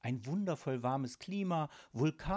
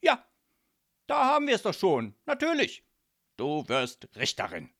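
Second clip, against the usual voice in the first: loud, high burst of self-satisfaction; bossy father delighted with his own plan; triumphant decision; 6 semitones higher, 11 dB louder than usual. A narrator's reading. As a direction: exclaim it as a sudden brilliant idea, then decree it. Father suddenly decides her career.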